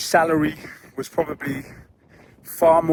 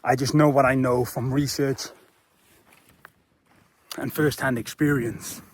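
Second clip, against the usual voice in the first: ordinary delivery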